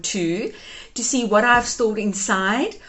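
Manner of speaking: ordinary delivery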